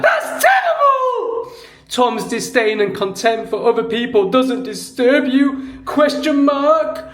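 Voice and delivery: very high, loud hysterical mimicry; shrieking mock commenter. Mocking Sarah's outraged comment, high and shrieking. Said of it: "And then Sarah's like".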